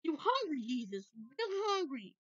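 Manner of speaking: high coaxing pet talk. Real, not acted. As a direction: coax the pet with food.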